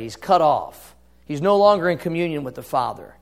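ordinary talk

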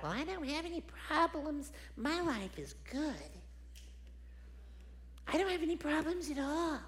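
mock someone blithely sure all is fine; carefree denier. Preacher imitating people who think life is good, high-pitched naive voice.

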